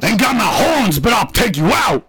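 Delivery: hammed-up tough-guy taunt; mock gangster ram.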